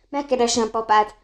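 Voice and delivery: ordinary delivery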